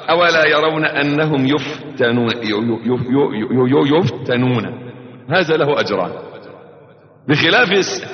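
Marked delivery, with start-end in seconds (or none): 0.1-4.9 halting, repeated ي- before يفتنون; stumbling reading. Shown as a demonstration. Sheikh imitating a struggling Quran reader, halting and stammering. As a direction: stammer and restart on each syllable of يفتنون. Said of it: "يتتعتع فيها، يحاول يقرأها".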